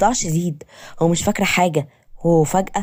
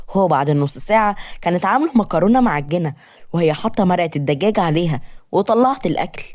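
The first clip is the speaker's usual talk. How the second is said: usual speaking voice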